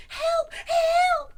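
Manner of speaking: very high, loud squealing cry for help; panicked cry. A narrator's reading. Piglet sees the monster, high panicked screaming. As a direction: shriek for help in a tiny panicked voice. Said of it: "cried Piglet".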